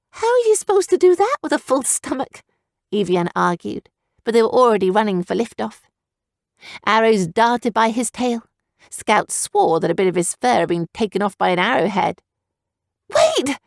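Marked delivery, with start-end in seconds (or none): 0.1-2.4 put-on high, skeptical girl's voice; arguing little sister. A narrator's reading. 13.1-13.6 very high urgent cry. A narrator's reading. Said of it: "Evian cried".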